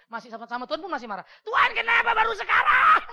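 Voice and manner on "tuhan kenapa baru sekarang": mock complaint. Wife complains to God, laughing.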